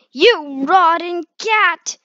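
a loud, high, slow squawk at the cat; loud, high, squawking insult; angry squawk. Acted. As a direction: screech it like a furious bird. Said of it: "squawked Lovebird".

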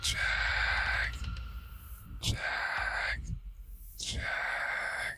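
drawn-out unvoiced hiss, fading; delighted hiss. A narrator's reading. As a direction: savour the name, gloating from the mist. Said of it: "The voice hissed delightedly".

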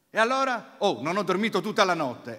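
loud, high burst; agitated father. Retold or imitated. Father confessing to his son he didn't sleep, loud. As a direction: blurt it out, upset.

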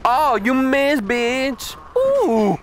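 excited taunt. Real, not acted. Taunting a boss in a fight.

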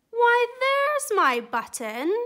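high, loud and slow with joy; delighted cry. Acted. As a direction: cry out happily at the find. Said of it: "he cried".

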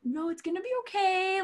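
mock soothing. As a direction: coo at a tantrum. Producer soothing the star.

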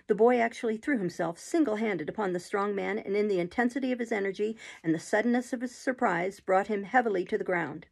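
plain reading voice

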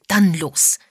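eager to get going. A narrator's reading. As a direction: launch in keenly. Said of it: "sagte Agatha eifrig".

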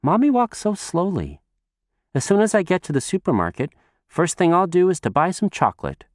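put-on high, fast child chatter; girl running to the supermarket, high and quick; little girl voice. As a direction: chatter on like an eager small girl.

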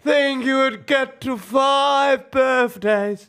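extremely drawn-out high, loud voice; Kat. Acted. Girlfriend marvels at five birthdays, slow high impersonation. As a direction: drag every syllable out, high and loud. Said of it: "Kat, Ben's girlfriend".

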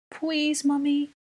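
put-on very high, drawn-out small-child plea; begging child. A narrator's reading. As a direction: beg like a little kid, stretching "please". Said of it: "Aurelian begged".

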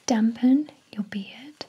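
usual speaking voice

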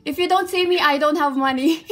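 loud, high, half-laughing plea; excited plea. Real, not acted. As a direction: beg your idol loudly, giddy. Pleading to idol on call.

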